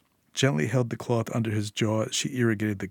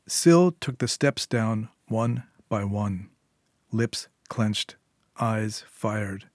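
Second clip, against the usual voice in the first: ordinary delivery, nothing marked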